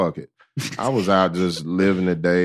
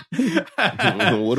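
high, slow, laughing through it; 11 semitones higher than usual. Real, not acted. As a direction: laugh while asking. Guest laughs at the confusion.

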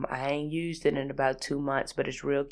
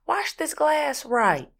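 higher, slow scolding command; mock scolding; 10 semitones higher, 4 dB louder, slower than usual. Scolding a friend over a lipstick glass, slow and high. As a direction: lay down the law, drawn out.

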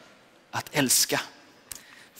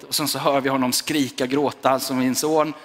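usual speaking voice

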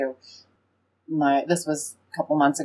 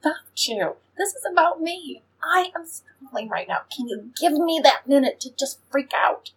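mock outburst, much higher; retold yell at boyfriend; 9 semitones higher than usual. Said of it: "And I just yelled at him".

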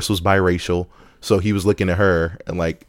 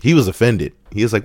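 usual speaking voice

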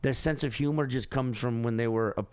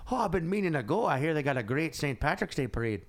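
put-on chatty Dublin cabbie in an Irish accent; Irish cab driver. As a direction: chat away with an imitated Irish lilt. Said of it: "He's like".

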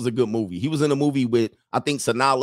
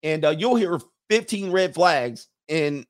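ordinary talk

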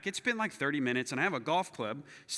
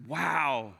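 awed wow; 3 semitones lower than usual. Retold or imitated. Awed reaction to the shot.

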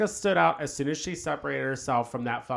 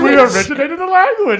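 much higher, louder mock British queen; imitating drag queen; 13 semitones higher, 14 dB louder than usual. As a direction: deliver the sassy comeback in her voice. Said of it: "that joke that she said to Trinity".

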